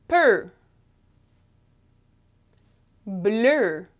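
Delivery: "Peu", "Bleu", "Feu", "Je", "Pneu", "Adieu" one by one, each ending on a held "er"-like vowel; French pronunciation drill. Shown as a demonstration. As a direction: model "Peu" to "Adieu" with the closed eu, long pauses between. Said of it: "They all have the sound".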